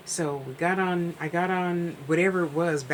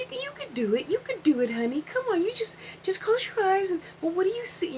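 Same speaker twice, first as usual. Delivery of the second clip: very high sweet granny voice; Granny encouraging. Retold or imitated. Granny guiding a nervous young reader, high sweet coaxing. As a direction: lift into a tender high granny coax. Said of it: "And she".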